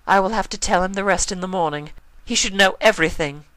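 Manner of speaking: lightly voiced refined mare; Rarity's voice. A narrator's reading. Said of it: "Rarity said".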